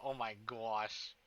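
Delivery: ordinary delivery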